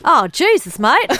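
hammed-up Australian accent, pitched high and loud; Mark Little. Quiz host impersonating a celebrity, hammed-up Australian accent. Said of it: "Mark Little, I can do that".

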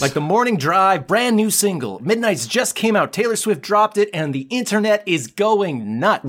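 high, excited radio DJ; morning DJ. Shown as a demonstration. Morning DJ announcing a new single, high-pitched hype. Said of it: "as if you're a morning D J".

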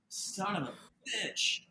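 slow, soft disbelief; retold stunned oath. Inn owner hears of the red lady, retold slow astonishment. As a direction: let the curse out slowly and quietly, imitating someone stunned. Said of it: "And he said".

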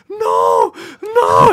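huge drawn-out mock scream; imitated panicked manager. Manager sees worker jump the counter, imitated screaming protest. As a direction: yell it full out, imitating horror.

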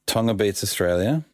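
ordinary talk, nothing marked

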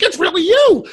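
very high, loud fangirl squeal; mock shriek in piercing falsetto; starstruck ex-wife. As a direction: imitate a woman shrieking at a celebrity. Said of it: "she's like".

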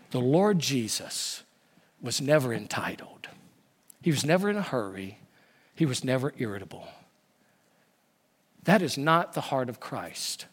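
put-on old pastor counseling his friend, soft and low; gentle counsel. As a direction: say it softly like gentle correction. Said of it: "I could hear him saying".

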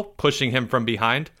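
plain reading voice

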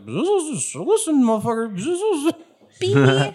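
high, agitated bee buzz with words slipped in. Acted. Game master as bee confronting friend, agitated high buzz. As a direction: buzz angrily around the words. Stung bee argues in buzzes.

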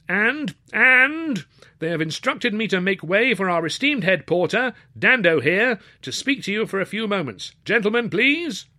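high, strained, pleading voice; flustered host. Acted. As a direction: beg the crowd for order. Nervous host introduces porter.

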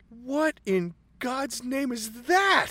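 slow, high, laughing disbelief. Retold or imitated. Dutch staring at the gut pile, slow and high, laughing. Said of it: "and he's like".

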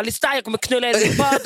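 hammed-up angry threat; mock furious threat. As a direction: bark it like you're losing it. Retold outburst at agent.

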